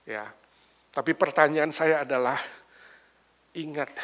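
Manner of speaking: ordinary talk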